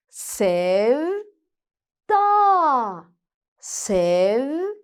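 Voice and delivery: sounding out words. Shown as a demonstration. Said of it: "Birleştiriyoruz".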